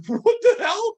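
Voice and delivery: high loud disbelief; shocked. Retold or imitated. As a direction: blurt it out, stunned. Shocked retold reaction.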